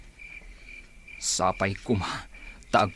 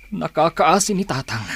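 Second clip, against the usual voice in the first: anguished cry; 6 semitones higher, 7 dB louder than usual. Acted. Grief over father bursts out.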